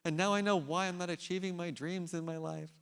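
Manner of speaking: a hint of a gloomy buddy whining; imitating depressed friend. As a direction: friend moaning after the speech, lightly mimicked. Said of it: "He's like".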